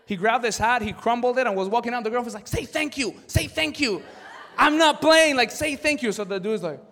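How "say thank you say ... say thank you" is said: put-on high, loud insistent girlfriend voice; mock nagging girlfriend, loud and high. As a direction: push him to say thanks, pitched up and loud. Girlfriend urging boyfriend in court.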